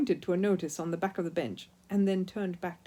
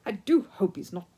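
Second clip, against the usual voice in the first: louder worried, stumbling voice; mother worrying aloud, louder; anxious; 4 semitones higher, 6 dB louder than usual. A narrator's reading. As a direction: fret with a stammer. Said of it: "said Missus Brown anxiously".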